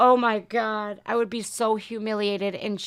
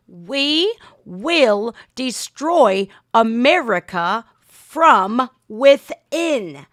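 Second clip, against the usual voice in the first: very slow and louder, dragged out like a villain's threat; ominous villain quote; 7 dB louder, much slower than usual. Retold or imitated. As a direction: spell out the threat word by word. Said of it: "This is what China has said about America".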